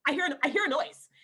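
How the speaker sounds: acting out short-tempered interruptions; short, snippy. Actor to husband mid-audition, short and stressed. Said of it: "I get really short".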